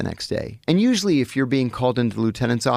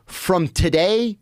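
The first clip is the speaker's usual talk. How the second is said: slow, deliberate guard's ruling, higher; stern prison guard; 5 semitones higher, slower than usual. Retold or imitated. Guard assigning the new inmate to the Italians, slow and firm. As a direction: lay down the law slowly, syllable by syllable. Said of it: "He goes".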